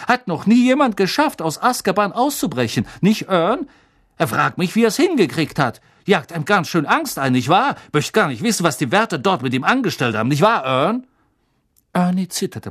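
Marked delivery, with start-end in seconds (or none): 0.1-11.1 higher, louder gossipy conductor voice; chatty bus conductor. Acted. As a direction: play Stan as an eager young gossip. Stan voiced by narrator.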